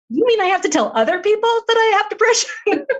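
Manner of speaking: high, fast, disbelieving squeak; can't believe what she's being asked; incredulous. Retold or imitated. Heidi reacting to the therapist's request, high incredulous voice.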